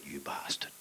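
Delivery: hushed under-the-breath curse, voice dropped away; whispered curse. Retold or imitated. Comic curses a heckler in a whisper. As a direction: breathe it like a secret insult. Private thought on stage.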